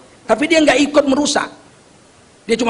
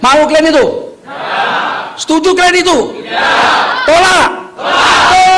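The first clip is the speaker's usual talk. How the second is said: seruan lantang; 5 semitones higher than usual. Real, not acted. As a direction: fire questions at the crowd to rouse them. Rallies the crowd to reject. (berseru lantang membakar semangat jemaah)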